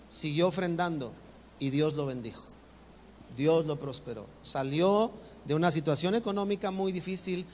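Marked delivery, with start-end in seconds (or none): none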